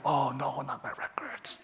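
whispered, sinking "oh no"; dismayed whisper. Retold or imitated. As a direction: breathe it out as the heart sinks. Realizing he must give up records.

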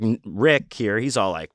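ordinary delivery

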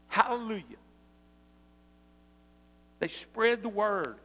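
preacher climbing to a joyful hallelujah; rising preaching. Acted. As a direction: lift the congregation, build to hallelujah. Preaching at full force.

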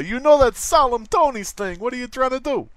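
high, hammed-up Italian mobster; mock Italian accent. One Tony scolding another, mock Italian accent.

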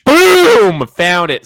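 loud, high burst of triumph; excited shout. Real, not acted. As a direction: blurt it out, thrilled. Finds the flag online.

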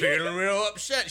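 high, loud put-on Hulk voice; mock Hulk upset. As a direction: play Hulk getting worked up. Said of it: "I'm getting angry".

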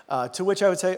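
ordinary talk

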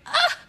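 short high cry of feels; mock anguished squeal. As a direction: squeal as if it hurts. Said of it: "You're just like".

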